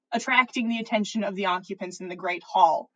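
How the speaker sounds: ordinary delivery, nothing marked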